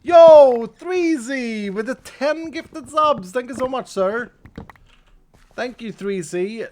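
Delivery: high, loud hype shout-out to a donor; excited thanks. Real, not acted. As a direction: hype up the gifted subs. Thanking a gifted-sub donor.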